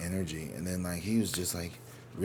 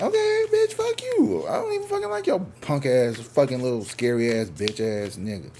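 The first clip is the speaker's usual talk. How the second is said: indignant outburst; 6 semitones higher, 5 dB louder than usual. Retold or imitated. Reacting to a date who never texted back, hammed-up outrage. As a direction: explode high, then come down to punched-out abuse. Acted reaction to being ignored.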